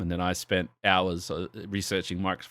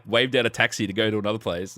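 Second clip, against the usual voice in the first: normal talking voice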